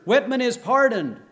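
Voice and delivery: loud, high desperate cry; shout. Acted. Old man stops the hanging from afar, shouting. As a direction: yell to stop the hanging. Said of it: "shouted Peter".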